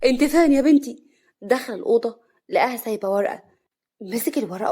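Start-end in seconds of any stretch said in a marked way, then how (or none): 0.0-1.0 louder, calling out puzzled. A narrator's reading. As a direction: call for her, wondering where she went. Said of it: "صالح باستغراب". (باستغراب)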